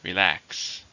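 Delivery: "Relax" with the weight on LAX; stress on second syllable. Shown as a demonstration. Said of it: "stress in the second".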